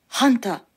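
ハンタ as "HAN-ta", with an H; variant pronunciation. Shown as a demonstration. Teacher listing other pronunciations, ハンタ with an H sound. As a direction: say ハンタ with H and an "ah" vowel. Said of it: "と発音する人もいます".

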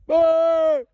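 high, held one-syllable holler; calling out. Real, not acted. Hiker hollering into the woods, calling out. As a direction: holler long to get attention.

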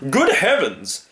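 high, startled exclamation; theatrical hunter's alarm; alarmed hunter crying out. Acted. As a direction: cry out at the sight of a lion. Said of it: "cried the hunter".

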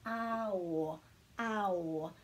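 "ao" slowly, gliding from a into o; vowels sounded separately. Shown as a demonstration. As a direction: draw out "ao" so each vowel is heard. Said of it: "breaking them at every vowel".

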